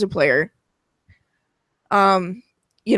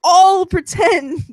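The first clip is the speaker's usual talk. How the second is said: "all" drawn out high, slow and insistent; exasperated emphasis; 8 semitones higher, 4 dB louder, slower than usual. Real, not acted.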